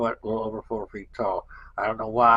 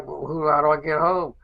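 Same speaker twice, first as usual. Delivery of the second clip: higher, louder, stumbling question; flustered disbelief; 6 semitones higher, 7 dB louder than usual. Retold or imitated. Stranded patient asks how she gets home, higher and louder in flustered disbelief. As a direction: sputter it out, caught off guard. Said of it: "So we're like".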